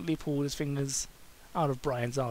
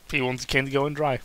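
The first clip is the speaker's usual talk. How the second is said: plain reading voice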